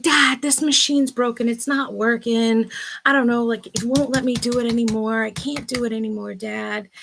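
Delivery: a hint of a confused kid begging dad; flustered daughter.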